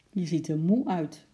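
plain reading voice

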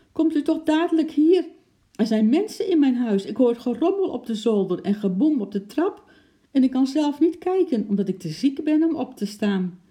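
high, loud, panicky pleading; urgent phone plea. A narrator's reading. Sick man begs police to come, high and urgent. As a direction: beg for help right away. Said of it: "riep hij door de telefoon".

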